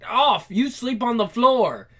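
ordinary delivery

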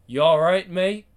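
loud, friendly call with a rising end. Acted. Stranger calls out to a grieving man. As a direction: hail him from across the way.

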